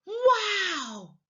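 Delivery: WOW high and drawn out, gliding down; a long, amazed exclamation; surprised and excited. Shown as a demonstration. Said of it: "That sounds much better than just".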